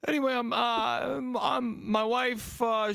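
high, overwrought New York character voice; shitty Northeastern accent. Acted. Husband's farewell about his wife, hammed-up Northeastern accent. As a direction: wail it in a bad Northeastern accent. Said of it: "of this Northeastern person".

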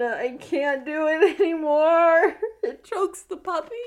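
high, loud laughing cry; helpless laughter. Real, not acted. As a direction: collapse into laughter.

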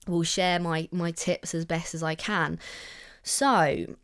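ordinary delivery

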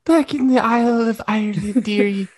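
high mock Irish granny voice; Irish accent. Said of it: "I, Irish".

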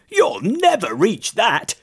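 high-pitched, dismissive scoff; scoffing toad. A narrator's reading. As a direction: pour scorn on the idea. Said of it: "scoffed the Toad".

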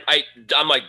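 usual speaking voice

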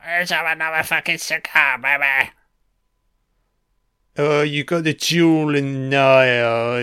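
lightly put-on sleazy party guy voice; sleazy movie character.

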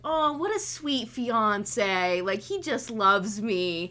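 slow, drawn-out lovey-dovey gush. Retold or imitated. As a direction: swoon over a sweet fiancé, slowly. Mimics her own smitten naivety.

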